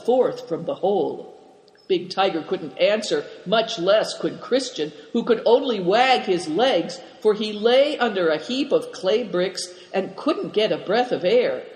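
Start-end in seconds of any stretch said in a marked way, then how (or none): none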